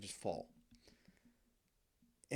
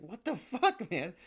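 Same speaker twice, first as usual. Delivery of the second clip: put-on much higher, louder kid's yelp of outrage; outraged little brother; 9 semitones higher, 6 dB louder, slower than usual. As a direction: squeal like a smacked little brother. Brother slapped in the face.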